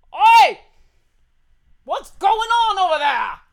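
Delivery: loud, high call across the caravan; a sharp shout to ask what's going on; yelling out. Acted. A gentleman at the front yelling out to the cart. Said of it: "gentleman yell out".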